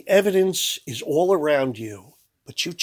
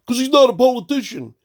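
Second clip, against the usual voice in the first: high, louder mock voice of a clueless voter; mocking dumb voter; 9 semitones higher, 5 dB louder than usual. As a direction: sneer it like a naive fan. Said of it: "You know the way Americans talk".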